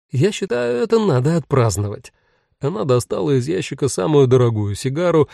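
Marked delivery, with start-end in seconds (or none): 0.1-0.8 much higher, smiling wife; higher woman's voice. Acted. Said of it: "улыбнулась Вера". 0.8-2.1 smiling Vera. Acted. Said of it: "улыбнулась Вера".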